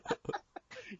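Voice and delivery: squealing laugh; high laugh. Real, not acted. Laugh after quote.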